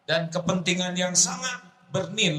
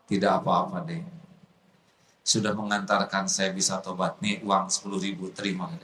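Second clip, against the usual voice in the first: low calm forgiving; 8 semitones lower than usual. Acted. Preacher voiced low and calm.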